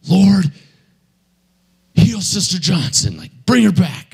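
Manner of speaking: high, pleading command to heal; preacher begging God at the deathbed; fervent prayer. Retold or imitated. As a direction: call on the Lord, pitched high. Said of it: "he's all".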